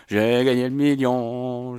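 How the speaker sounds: slow, relishing each word; slow savoring. Real, not acted. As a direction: savor the win slowly. Repeats the win slowly.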